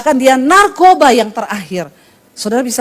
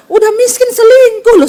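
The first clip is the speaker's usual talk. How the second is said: indignant exclamation; 11 semitones higher, 5 dB louder than usual. Real, not acted. Preacher mocking broke cheaters.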